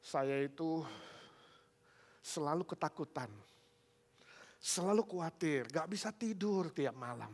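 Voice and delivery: lightly acted weepy man; mildly whimpering worried voice; imitated tearful plea. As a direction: plead as if close to tears, with halting pauses. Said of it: "Dia bicara sambil nangis".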